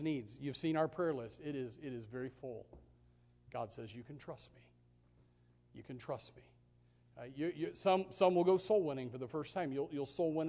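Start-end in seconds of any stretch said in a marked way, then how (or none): 3.9-6.4 soft voice of God. Acted. God's reassuring voice.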